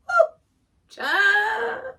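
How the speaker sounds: sudden very high, loud whoop; exclaiming in shock. Real, not acted. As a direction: blurt it out in disbelief. Shocked at the loud upload music.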